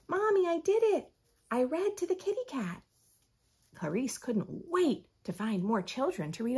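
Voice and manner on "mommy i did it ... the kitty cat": put-on proud little girl. Girl telling her mother she read aloud, excited and higher. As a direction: burst out with pride to mommy.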